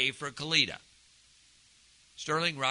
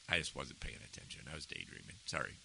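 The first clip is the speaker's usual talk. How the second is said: sheepish admission; 12 dB quieter, faster than usual. Retold or imitated. Retold sheepish honest confession.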